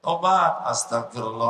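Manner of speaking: ordinary talk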